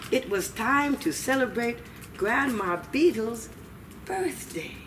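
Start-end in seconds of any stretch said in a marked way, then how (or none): none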